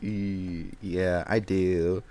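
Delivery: low, slow, hesitant confession; reluctant admission. Retold or imitated. Admitting the podcast to the boss, retold reluctance. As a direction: drag it out, low and sheepish.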